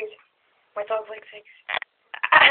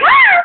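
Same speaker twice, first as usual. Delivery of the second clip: shriek; 8 dB louder than usual. Retold or imitated.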